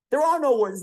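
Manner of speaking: usual speaking voice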